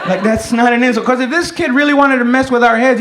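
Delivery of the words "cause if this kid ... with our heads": normal talking voice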